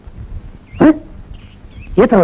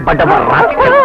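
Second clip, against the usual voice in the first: angry insult; 12 semitones higher than usual. Acted. Boss insults the candidate.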